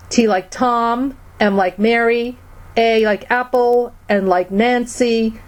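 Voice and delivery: slow, letter-by-letter dictation; measured spelling for listeners taking it down; slow careful spelling. Real, not acted. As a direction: spell it out slowly, pausing after each letter. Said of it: "spell it really slowly for you all".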